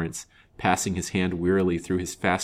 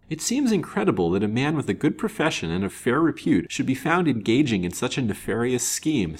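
higher-pitched, incredulous; amazement; 6 semitones higher, 3 dB louder than usual. A narrator's reading. Banker cannot believe a respected doctor would scheme, amazed. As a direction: marvel that it could happen. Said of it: "said Mister Lawrence in amazement".

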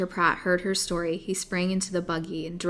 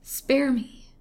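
high, louder frightened plea; pleading moan; 7 semitones higher, 5 dB louder than usual. Acted. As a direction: beg like a terrified victim. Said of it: "moaned the poor victim between her chattering teeth".

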